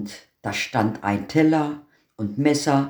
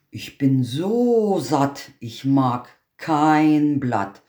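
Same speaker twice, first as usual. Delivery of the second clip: slow, drawn-out rhyme; content goat; slower than usual. Acted. The goat answers the tailor slowly, contented. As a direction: stretch every word, satisfied. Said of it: "Sie antwortete".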